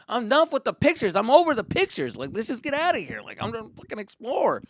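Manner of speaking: high, loud impatient protest; fed-up kid. Retold or imitated. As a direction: complain like an impatient child.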